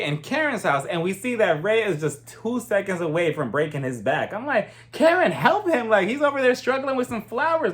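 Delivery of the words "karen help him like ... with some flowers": high-pitched mock exasperation; exasperated urging. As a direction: plead up high as if calling her to act. Urging wife to help struggling husband.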